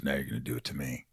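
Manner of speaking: imitating Piper, low and quick; imitating the wrestler's dare; low, fast wrestler voice. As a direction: drop low and rattle it off. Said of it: "And he goes".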